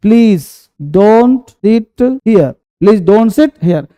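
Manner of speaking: very slow, each word apart; slow dictation. Shown as a demonstration. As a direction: dictate it for students to write. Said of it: "teacher dictating slowly". (बहुत धीरे, शब्द-शब्द करके)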